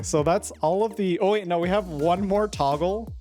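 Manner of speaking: ordinary delivery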